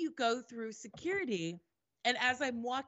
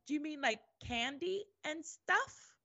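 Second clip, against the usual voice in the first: higher, questioning feigned innocence; playing innocent; 7 semitones higher than usual. Traveler asked about food by customs, playing innocent. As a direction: act clueless, lifting into a question.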